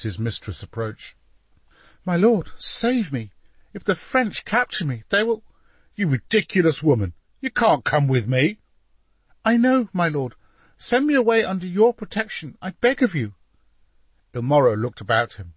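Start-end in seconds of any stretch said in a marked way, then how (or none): 2.0-5.4 high-pitched plea of a frightened mistress; desperate plea. A narrator's reading. Mistress to Duke as the French approach, desperate plea. 5.9-8.6 louder, higher scolding; irritated rebuff. A narrator's reading. Duke to mistress begging to flee along, irritated rebuff. 9.4-13.3 high, urgent begging; pleading. A narrator's reading. Mistress to Duke, begging for his protection, pleading.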